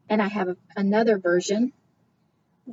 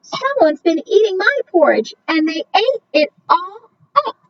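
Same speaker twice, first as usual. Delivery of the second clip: put-on high, small baby bear voice; wee small Baby Bear voice; 10 semitones higher, 4 dB louder than usual. A narrator's reading. Narrator voicing Baby Bear finding his empty bowl, high and small. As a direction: squeak it out like a tiny cub. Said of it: "in his wee small voice he said".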